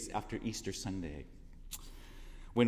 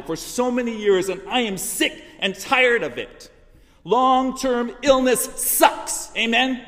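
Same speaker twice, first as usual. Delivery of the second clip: angry outburst; 11 semitones higher, 12 dB louder than usual. Retold or imitated. Speaker voicing her own soul to the congregation, angry shout. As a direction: pour out years of pent-up anger. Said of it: "spoke from the depths of his soul".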